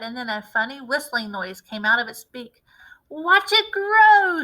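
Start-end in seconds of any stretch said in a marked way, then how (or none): 3.1-4.4 slow, higher, louder triumphant shout. A narrator's reading. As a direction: call it out in triumph. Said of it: "shouted Mister Cranky".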